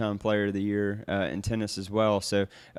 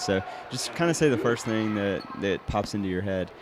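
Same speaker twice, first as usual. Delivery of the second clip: usual speaking voice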